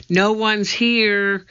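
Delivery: high, slow, drawn-out call; shouting back. A narrator's reading. Annie answers from up in the treehouse, shouting back. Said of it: "she shouted back".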